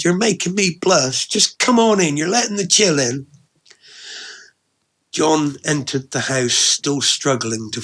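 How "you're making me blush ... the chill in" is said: high-pitched, bashful woman's voice; flustered, playful scolding. Acted. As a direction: shoo him inside, pleased and embarrassed. Flattered woman hurries him in.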